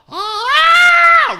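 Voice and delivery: really loud, very high shriek; imitated peacock scream. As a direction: screech like a peacock calling out. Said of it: "like, really loud".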